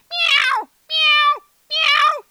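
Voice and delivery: loud high meows; cat meowing. A narrator's reading. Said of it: "The cat meowed".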